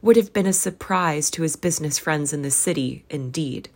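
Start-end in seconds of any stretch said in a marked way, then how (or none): none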